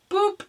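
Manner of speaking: high cartoon boop; mock boop. Vlogger imitating the brazier tipping, high boop. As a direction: squeak it like a cartoon tip-over.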